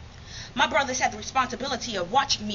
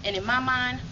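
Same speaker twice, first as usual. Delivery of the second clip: ordinary talk, nothing marked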